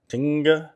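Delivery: "Tinga" with an EE-like i, rising like a question. Shown as a demonstration. Vlogger checking whether the dish has an I. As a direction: ask "Tinga" with an I sound. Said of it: "I know there's an I".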